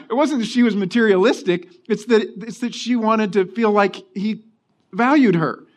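normal talking voice

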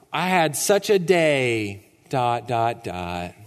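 low, slow mopey sigh; mock sigh. Preacher mocking a sad Facebook status. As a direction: drag it out like fishing for sympathy.

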